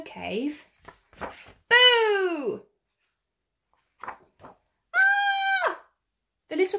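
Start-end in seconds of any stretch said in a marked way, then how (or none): 1.2-2.7 loud high boo; shouted boo. Acted. Animals ambushing the cub at home, loud boo. 4.0-5.8 very high scream; frightened scream. Acted. Cub scared in his snow cave, high scream.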